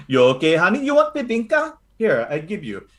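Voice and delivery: lightly voiced doting Filipino grandmother; a hint of a fussing lola reassuring the party; grandma Lola Carly. Lola Carly fussing over the recruits, lightly voiced.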